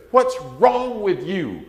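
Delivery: high, loud, scolding parent voice; exasperated parent. Retold or imitated. Parent scolding a little five-year-old in a loud, exasperated voice. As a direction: scold the child like a fed-up parent. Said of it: "you'll have parents say".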